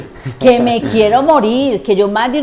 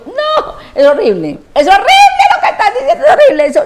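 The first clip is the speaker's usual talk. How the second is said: much higher, louder mock outrage through laughter; hammed-up shock while laughing; laughing mock horror; 12 semitones higher, 7 dB louder than usual. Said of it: "pues como que dicen".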